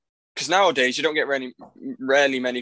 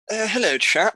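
high-pitched mock English gent; caricature British accent; 8 semitones higher than usual. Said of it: "we don't sound like".

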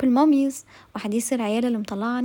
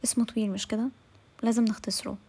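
usual speaking voice

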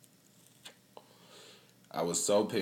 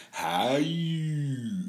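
imitating Maya; 6 semitones higher than usual. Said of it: "she be sitting over there to my son".

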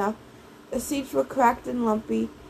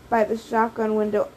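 ordinary delivery

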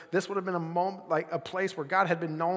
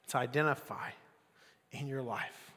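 ordinary delivery

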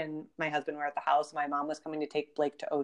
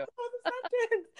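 retold amazement. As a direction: squeak it out in mock astonishment. Repeats amazed reaction.

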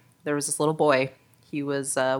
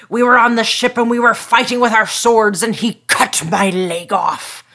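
loud, raised, hearty pirate growl with a long arr; 5 semitones higher, 7 dB louder than usual. Acted. Pirate telling a little boy his tale, booming. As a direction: spin a sea yarn like a storybook pirate. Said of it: "And the pirate says".

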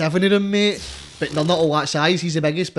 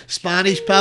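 loud, high imitation of a passer-by; mock customer jibe; 6 semitones higher, 8 dB louder than usual. Repeats customers' jibe at sellers.

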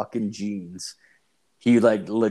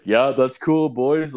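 higher, breezy mock-casual "whatever"; nonchalant reply; 4 semitones higher, 6 dB louder, slower than usual. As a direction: shrug it off lightly to the tough guys. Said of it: "I was like".

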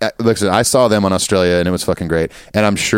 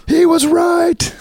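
hammed-up, high-pitched awe; exaggerated stunned squeal; mock amazement; 9 semitones higher, much slower than usual. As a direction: act blown away, drawing the words out. Pretend amazed after supposedly listening.

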